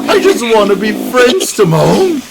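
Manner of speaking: high, loud pleading cartoon warthog; Pumbaa voice. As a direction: beg like a hurt cartoon sidekick. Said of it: "Pumbaa's like".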